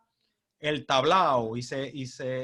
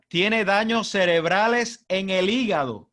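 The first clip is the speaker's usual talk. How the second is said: mock alarm; 5 semitones higher, 5 dB louder, slower than usual. Reading absurd news, mock alarm.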